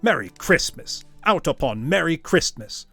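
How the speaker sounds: loud, heated tirade; angry rant. Acted. Scrooge railing at his nephew, loud angry tirade. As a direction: rail against Christmas.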